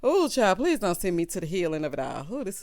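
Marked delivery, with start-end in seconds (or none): none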